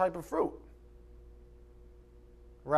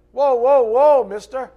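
loud, high "whoa, whoa" like reining someone in; hammed-up lawyer cutting in; mock lawyer shout; 7 semitones higher, 12 dB louder, slower than usual. Preacher acts lawyer stopping Jesus.

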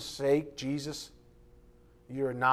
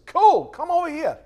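high, loud, mock-cheerful welcome; a sudden bright, loud invitation; voicing Jesus; 14 semitones higher, 12 dB louder than usual. Jesus voiced in a story.

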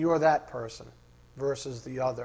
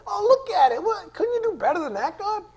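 high, scornful put-on sneer; sneering mocker; 5 dB louder than usual. Preacher voices a scoffer mocking God, high and sneering. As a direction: jeer at God's work in a much higher voice.

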